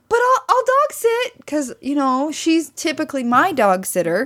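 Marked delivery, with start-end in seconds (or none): 0.1-1.4 eager offer. Retold or imitated. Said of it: "I was like".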